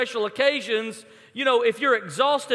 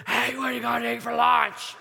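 whisper mixed with voice. Retold or imitated. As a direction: mimic a badly botched whisper. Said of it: "mix a whisper with a voice".

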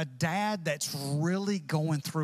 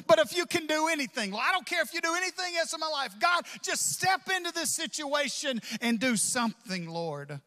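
desperate plea; 9 semitones higher than usual. Acted. Desperate father pleading, retold.

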